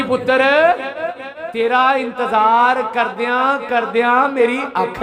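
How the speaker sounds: high loud Punjabi mother; mother's words. Retold or imitated. Preacher voicing his mother's loving words, high loud cry.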